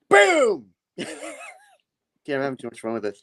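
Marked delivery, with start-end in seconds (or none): 0.1-0.7 high, loud mock blast; explosive boom. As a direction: burst out with a cartoon explosion. Imitates explosion in villain's taunt.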